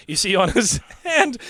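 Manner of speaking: trying not to crack up; laughing through narration. Real, not acted. Narration retaken while still laughing.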